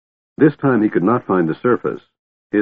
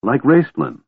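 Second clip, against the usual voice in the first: higher-pitched, abrupt addition; sudden realization; 6 semitones higher than usual. A narrator's reading. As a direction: add it as the likeness dawns. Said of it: "Tika said suddenly".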